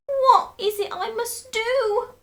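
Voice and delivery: very high, loud, slow; desperate cry. A narrator's reading. Desperate wife cries very high what to do. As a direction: plead high and drawn out. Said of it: "cried Missus Twit".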